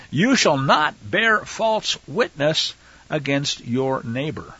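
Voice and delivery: slow, word-by-word scripture reading; measured, emphatic quoting; deliberate recitation. Real, not acted. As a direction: recite the commandment with space between phrases.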